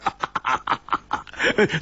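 airy unvoiced giggle; breathy laugh. A narrator's reading. Hu Tiehua laughing at his own joke, breathy laugh. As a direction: let out a soft breathy laugh.

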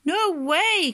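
high, emphatic storybook cry; cried refusal. A narrator's reading. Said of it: "cried the crabs".

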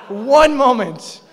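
loud, drawn-out indignant cry; slow, aggrieved holler; exasperated protest. Retold or imitated. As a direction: stretch out the complaint at full voice. Acted exasperated protest after the frog.

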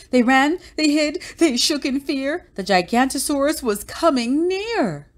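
high, urgent storybook narration; suspenseful panic. A narrator's reading. Story tension builds as monster nears.

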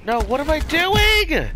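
high, slow, panicked wail; frantic cry. Real, not acted.

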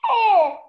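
high, loud falling cry of shock; hammed-up gasped "oh"; stunned loud "oh". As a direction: let out a floored "oh". Acts her stunned reaction.

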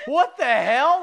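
loud, high what the hell; retold shock at the diaper blowout; shocked outburst. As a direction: blurt it out in disgust. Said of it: "I was like".

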